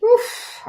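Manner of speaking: sharp breathy oof at a bad card; unvoiced oof. Real, not acted. Reader reacts to heavy tarot card, wincing oof. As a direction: wince audibly.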